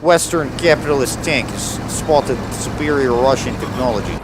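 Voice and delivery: hammed-up Russian tank commander accent; fake Russian accent. Player role-plays a Russian tanker mid-battle, fake Russian accent. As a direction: put on a thick, mock Russian accent.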